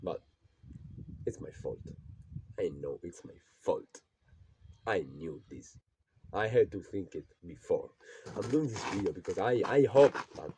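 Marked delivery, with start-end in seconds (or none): 0.0-5.8 soft, lower, near-whispered self-blame; half whisper it to yourself; quiet admission. Real, not acted. Young man blaming himself, quiet and low. Admitting his fault after long pause.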